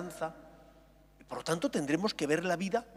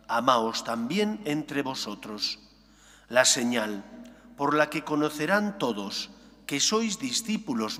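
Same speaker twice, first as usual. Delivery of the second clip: solemn Gospel proclamation, louder; liturgical reading; 2 semitones lower, 4 dB louder than usual. Acted. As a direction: proclaim it from the pulpit. Priest proclaims the Gospel aloud.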